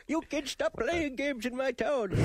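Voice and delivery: put-on slow, high cranky old man. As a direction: scold like a grumpy old geezer. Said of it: "Just use your old man voice".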